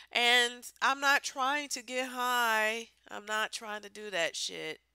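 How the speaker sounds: ordinary delivery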